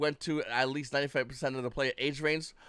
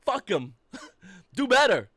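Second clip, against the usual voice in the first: louder, slow, blunt demands; defiant demand; 5 semitones higher, 6 dB louder, slower than usual. Retold or imitated. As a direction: lay into the company with a raised voice. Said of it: "When it should be like".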